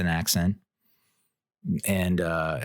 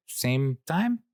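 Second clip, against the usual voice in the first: confused server; 10 semitones higher, slower than usual. Retold or imitated. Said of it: "she was like".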